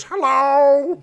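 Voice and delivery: put-on high, quavering old lady. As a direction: answer the phone like an old lady. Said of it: "I do an old lady voice".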